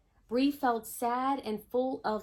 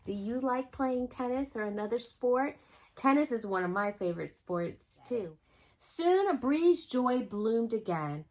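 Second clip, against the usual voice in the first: ordinary delivery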